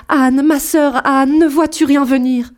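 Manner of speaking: appel aigu, lent et étiré, angoissé; traîne le nom de la sœur comme une plainte. A narrator's reading. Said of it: "Et puis elle criait". (l'épouse implore sa sœur une dernière fois, appel angoissé)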